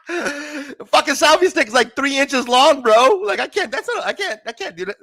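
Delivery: high, loud, laughing delivery; talking through laughter. Real, not acted. Host laughing helplessly over a three-inch selfie stick. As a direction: barely get it out for laughing.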